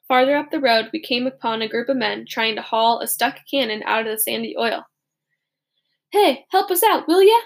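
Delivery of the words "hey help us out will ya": loud, higher pleading call; begging shout. Acted. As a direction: call out for help with the cannon. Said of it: "the artillerymen begged".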